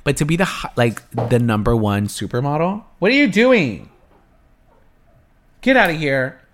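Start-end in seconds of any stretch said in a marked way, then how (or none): 3.0-6.4 higher, louder call at the noise; annoyed call toward the ceiling; exasperated yell upstairs. Real, not acted. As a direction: shout up at the thumping neighbour. Yelling at a noisy neighbour.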